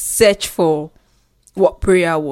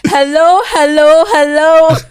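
high, loud mock hellos; teasing imitation; 10 semitones higher, 9 dB louder than usual. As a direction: echo his hellos, mocking, high and loud. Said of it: "I wanted to say that".

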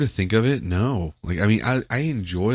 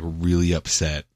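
ordinary delivery

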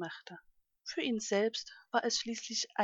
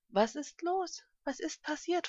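astonished question, pitched up high; 6 semitones higher than usual. A narrator's reading. Ostrich finds a crying little elephant, astonished. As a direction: ask in surprise, voice lifted. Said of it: "fragte er erstaunt".

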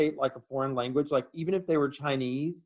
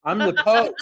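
mock grand Pope; 7 semitones higher, 5 dB louder than usual. Imagining himself as Pope.

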